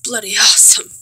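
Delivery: "bloody" punched loud for emphasis; stress on bloody. Real, not acted. Film fan giving the verdict, "bloody" stressed hard. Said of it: "Emphasis on bloody".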